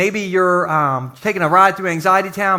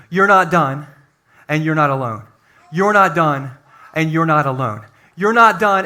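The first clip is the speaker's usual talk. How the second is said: louder with each repeat; building refrain; 4 dB louder than usual. Acted. Pastor driving the refrain home to the church, building. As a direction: preach it harder every time.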